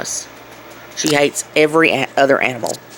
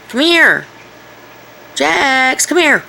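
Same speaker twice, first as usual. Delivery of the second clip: calling the dog; 8 semitones higher, slower than usual. Real, not acted.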